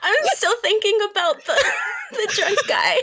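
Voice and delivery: high-pitched, squeezed out through real laughter; helpless laughter. Real, not acted. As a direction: barely get it out for laughing. Cracking up over earlier joke.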